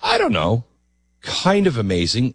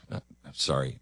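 ordinary talk, nothing marked

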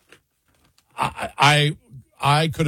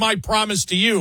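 lightly imitated campaign pledge, pitched up; a hint of the earnest politician, higher; Joe Biden at a rally; 6 semitones higher than usual. Host quotes the candidate's promise, lightly imitating. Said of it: "He goes".

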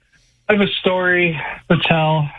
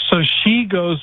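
ordinary talk, nothing marked

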